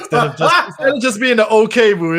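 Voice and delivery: words broken up by laughter; laughing. Real, not acted. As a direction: laugh through the line. Laughing while talking.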